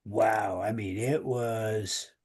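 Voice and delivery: ordinary talk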